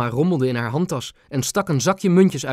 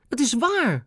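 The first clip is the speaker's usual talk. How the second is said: high, eager protest; boy insisting to the queen; boy's cry; 11 semitones higher than usual. A narrator's reading. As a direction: blurt it out to be believed. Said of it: "riep Ben".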